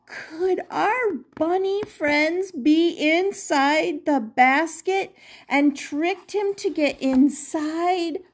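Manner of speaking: plain reading voice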